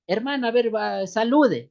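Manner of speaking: loud, high call to come up and greet; imitating a host calling someone to the mic. Campaign host calling a sister up to greet the crowd, loud call. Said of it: "en las campañas ha pasado".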